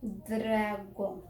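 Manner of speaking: "Dragón" drawn out on "draaa"; sounding out "dragón". Shown as a demonstration. Teacher repeats the example, sounding it out. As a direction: stretch "Dragón" with D and R blended. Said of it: "el ejemplo de dragón".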